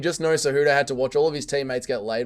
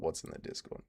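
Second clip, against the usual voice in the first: ordinary talk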